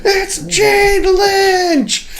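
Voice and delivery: very high, slow starstruck shriek; excited fan scream. Retold or imitated. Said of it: "She was very excited about it".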